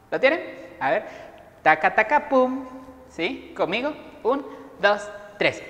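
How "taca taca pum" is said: higher, punchy vocal drum hit; drum imitation. Shown as a demonstration. Teacher cues the class, imitating drums. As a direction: sound out a drum fill. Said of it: "El primero que vamos a hacer es la batería".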